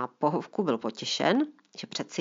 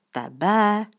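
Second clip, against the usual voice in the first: pochybovačně vyhrknuté; nevěřícně vyhrknout; doubtful blurt; 4 semitones higher, 7 dB louder than usual. Acted. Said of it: "vyhrkla Marina pochybovačně". (dcera nevěřícně vyhrkne na otce)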